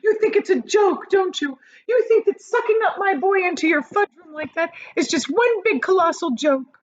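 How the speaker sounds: high, loud furious accusation; furious shout. A narrator's reading. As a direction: shout him down. Mother raging at Wonka.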